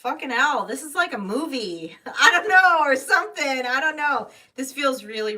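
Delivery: loud, high laughing exclamation; excited outburst. Real, not acted. Tarot reader overwhelmed by the reading, loud laughing outburst. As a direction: burst out, laughing in disbelief.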